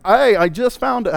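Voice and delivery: a hint of an Australian accent, meant as British; quick botched Australian accent. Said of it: "that came Australian".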